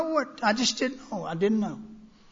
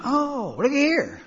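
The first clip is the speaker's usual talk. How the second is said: high, bright "oh, look at here" in mock discovery; mock surprise; 8 semitones higher, slower than usual. Opening the big book for the new drunks, in mock surprise. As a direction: play it like you just found something new. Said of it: "and I went".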